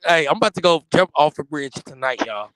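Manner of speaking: ordinary talk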